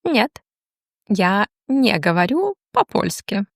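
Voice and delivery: ordinary delivery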